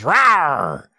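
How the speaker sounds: playful beast roar; monster roar. Acted. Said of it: "swipes at Aaron and roars".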